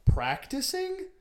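high, stretched-out disbelieving "Practicing?"; mock outrage at the principal, drawn out; incredulous. Retold incredulous reply.

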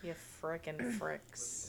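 under the breath, drawn out; quiet quote. Retold or imitated. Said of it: "the frickin' fricks vine".

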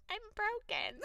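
high laughing self-mock. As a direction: squeal it and crack up. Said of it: "I'm over here like".

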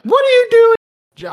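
put-on very high, loud, drawn-out old lady squeal; startled old woman catching him in her restroom; shocked old lady.

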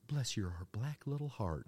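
lightly voiced Southern lady; a hint of pious Southern insult; Southern church lady.

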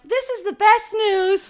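tiny forced cheerful voice; husband forcing positivity, squeaky; mock chirpy husband.

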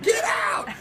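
high, laughing yelp of get out; mock shout. Husband shooing the scary sick cat, laughing yelp. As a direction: shoo the cat away, half laughing. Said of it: "I was like".

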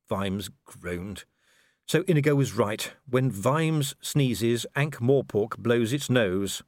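ordinary delivery

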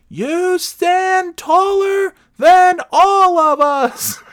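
very high, loud, drawn-out caricature; mock yelling. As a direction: bellow each word slowly at someone who can't hear. Third try to deaf woman, shouted.

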